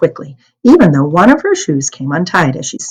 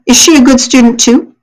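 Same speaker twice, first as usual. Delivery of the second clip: soldier's question; 7 semitones higher, 5 dB louder than usual. Acted.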